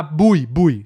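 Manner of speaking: "bui" dito como em português, bui; jeito errado. Shown as a demonstration. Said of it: "Se você falar bui". (pronúncia errada "bui" à brasileira)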